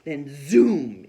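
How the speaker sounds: loud zooming sound; the bird flying off. A narrator's reading. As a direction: punch the zoom out.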